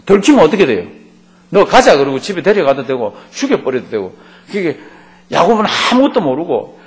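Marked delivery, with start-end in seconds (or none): 1.5-2.0 louder, higher gruff command from the uncle; mock angry order. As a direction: bark it like the uncle dragging her home. Uncle's threat, voiced in retelling. (외삼촌이 야곱 식구를 끌고 가려는 듯 큰 소리로 호통)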